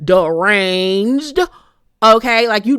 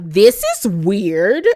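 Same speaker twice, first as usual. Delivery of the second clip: suspicious Stormy; much slower than usual. Retold or imitated. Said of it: "So Stormy was like".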